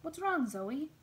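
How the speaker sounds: alarmed concern. A narrator's reading. Father answering his yelling child, alarmed concern. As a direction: answer a yell with urgent worry.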